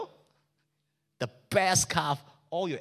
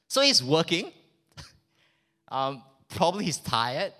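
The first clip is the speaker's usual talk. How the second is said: ordinary talk, nothing marked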